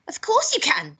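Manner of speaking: high, loud snap; snapping grandma. A narrator's reading. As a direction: snap back sharply, pitched well up. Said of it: "she snapped".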